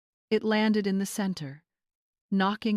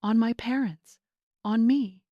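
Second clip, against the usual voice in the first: trembling disbelief; 5 semitones higher, 5 dB louder than usual. A narrator's reading. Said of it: "her voice trembling".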